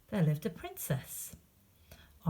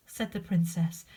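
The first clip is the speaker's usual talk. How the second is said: ordinary delivery, nothing marked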